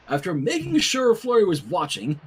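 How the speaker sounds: ordinary delivery, nothing marked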